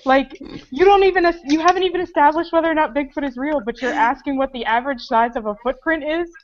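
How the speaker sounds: loud mock-indignant scolding; retold angry rant. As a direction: tear into her like she's being absurd. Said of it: "I'd say".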